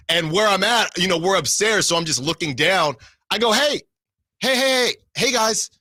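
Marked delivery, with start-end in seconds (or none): 3.5-5.7 calling out. Retold or imitated. Calling down to players from upstairs.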